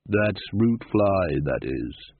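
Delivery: very slow, sinking low voice; menacing local. A narrator's reading. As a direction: drawl it ominously from the shadows.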